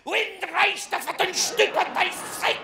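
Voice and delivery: mock German outburst. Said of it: "Et là, le mec". (faux allemand crié, accent allemand exagéré)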